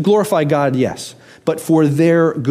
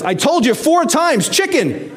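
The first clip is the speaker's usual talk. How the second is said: exasperated wife; 11 semitones higher than usual. Retold or imitated. Exasperated wife answering her husband.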